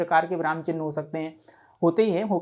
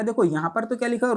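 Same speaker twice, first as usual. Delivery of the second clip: usual speaking voice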